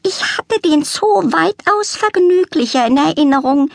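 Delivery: high, prim, put-upon lament; complaining cat lady. A narrator's reading. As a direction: sniff at the disappointing zoo. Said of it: "beklagte sie sich".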